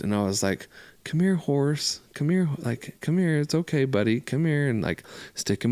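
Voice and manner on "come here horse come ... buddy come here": higher, gentle coaxing voice; soft coaxing. Retold or imitated. To the horse in the pasture, coaxing it near, soft and higher. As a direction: coax the skittish horse kindly, pitched up. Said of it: "like, soft".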